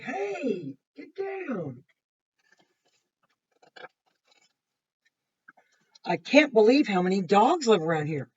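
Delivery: lively picture-book narration; reading to children. A narrator's reading. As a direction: read it to the class with energy. Reads Lisa's story aloud.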